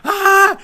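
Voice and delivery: very high, very loud excited scream; fan scream. Retold or imitated. Fans in the cinema at the credit scene, screaming. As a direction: shriek like a fan who can't believe it. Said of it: "Everyone starts, like, screaming".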